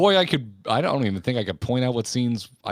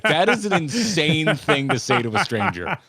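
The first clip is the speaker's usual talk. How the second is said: amazed, higher and a bit louder; incredulous; 6 semitones higher, 4 dB louder than usual. Retold or imitated. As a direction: disbelief at a stranger's remark. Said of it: "And I'm like".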